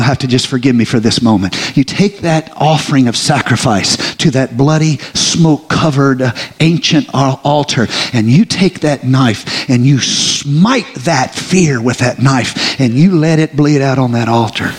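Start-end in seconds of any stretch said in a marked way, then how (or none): 3.2-14.7 slow, weighty sermon cadence; slow dramatic preaching. Real, not acted. Preacher describing slaying fear on the altar, slow and weighty. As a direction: lay each word down heavily.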